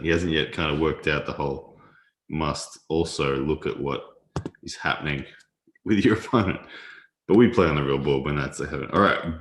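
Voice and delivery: ordinary talk, nothing marked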